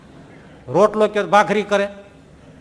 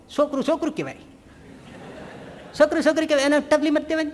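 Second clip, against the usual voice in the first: indignant father; 7 semitones higher, 4 dB quieter, faster than usual. Retold or imitated. Imitating father defending his son.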